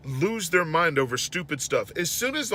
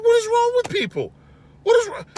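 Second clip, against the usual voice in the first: much higher, louder exasperated cry; 4 dB louder than usual. Retold or imitated. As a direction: throw up your hands at everyone. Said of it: "I'm like".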